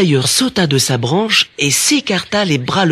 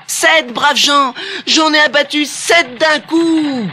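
high, slow town-crier boast; crying out; 13 semitones higher, slower than usual. A narrator's reading. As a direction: proclaim it to the crowd, stretched and high. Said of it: "qu'il brandit en criant".

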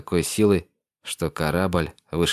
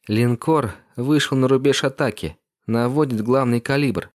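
higher, tense female officer; young woman's voice; 6 semitones higher than usual. Acted. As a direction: report the threat tensely. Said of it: "сообщила Дрея". (напряжённый женский голос)

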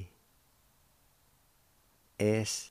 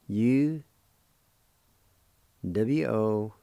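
ordinary talk

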